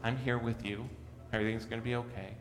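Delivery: put-on soft, quiet comfort to a scared child; gently calm a frightened child; reassuring parent. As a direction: parent soothing a child afraid of the dark, softly. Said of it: "but rather reassures them".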